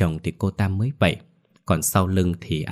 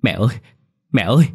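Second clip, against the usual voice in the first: from a cry to a loud, high sob; bursting into tears; 3 semitones higher, 6 dB louder, faster than usual. Acted. Son reaching for his mother's ghost, breaking into loud sobs. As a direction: call out to her as she slips away. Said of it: "anh bật khóc".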